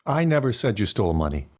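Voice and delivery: "I" stressed, the rest falling away. Shown as a demonstration. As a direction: hit the word "I" at the start. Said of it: "emphasize "I".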